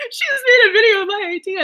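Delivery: retold teary laughing exclamation; emotional disbelief. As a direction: relive the overwhelmed joy. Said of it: "I was getting really emotional".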